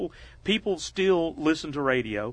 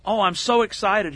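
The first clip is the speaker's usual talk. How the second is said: mildly acted excitement, higher and louder; Bill Gates quote; 7 semitones higher, 5 dB louder than usual. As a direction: imitate an eager guest, pitched up. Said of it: "And Bill says".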